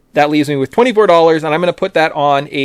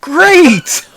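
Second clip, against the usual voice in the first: laughing; 11 semitones higher, 6 dB louder than usual. Real, not acted. Reacting to Austria joke.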